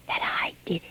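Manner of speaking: secretive whisper, barely breathed. Acted. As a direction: breathe the confession so no one hears. Confessing a secret in her diary.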